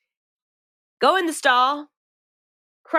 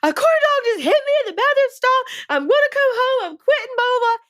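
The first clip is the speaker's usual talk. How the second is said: much higher, louder, near-hysterical outburst on the phone; frantic retelling; 4 dB louder than usual. Retold or imitated. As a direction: squeal it like you've hit your breaking point. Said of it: "and I'm like".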